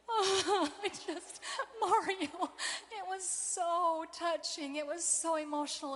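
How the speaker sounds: choked up, voice breaking high into tears; fighting back tears while praising the video; tearful. Real, not acted. Speaker moved to tears describing a video of boys.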